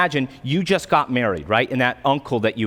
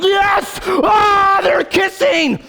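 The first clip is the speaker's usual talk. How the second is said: shrieking, much higher, mock-overjoyed yell; hammed-up ecstatic cheer; 5 dB louder, much slower than usual. Wedding guest watching the couple kiss, hammed-up ecstatic cheer. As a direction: scream it like a wildly overexcited fan.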